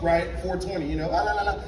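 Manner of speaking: ordinary talk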